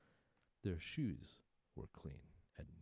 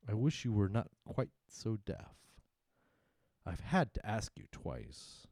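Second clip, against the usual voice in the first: irritated walrus; 5 semitones higher, 8 dB louder than usual. Acted. Walrus scolding deaf carpenter.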